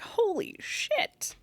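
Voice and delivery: imagined future self, stunned; amazed. Pretend. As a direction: savour it in awe. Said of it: "be like".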